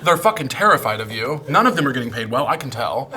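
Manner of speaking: ordinary talk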